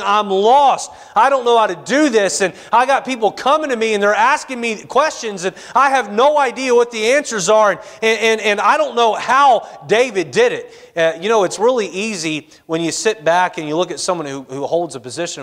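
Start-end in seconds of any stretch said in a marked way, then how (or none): 0.0-10.8 crying out to God. Retold or imitated. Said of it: "he's crying out to the Lord".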